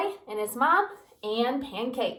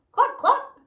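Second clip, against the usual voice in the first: high, loud clucks; squawky hen cluck; 9 dB louder than usual. A narrator's reading.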